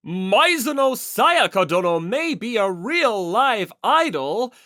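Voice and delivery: loud, slow, puffed-up otaku voice; proud otaku boast. Acted. Yamada boasting about the idol, loud proud otaku voice. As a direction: chest out, lecturing with pride. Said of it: "he stuck out his chest as he said".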